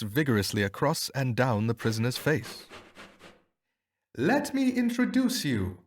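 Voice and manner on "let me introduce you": high ringing announcement; shouted. A narrator's reading. Detective unmasking the beggar, a high ringing shout. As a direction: proclaim it triumphantly. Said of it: "he shouted".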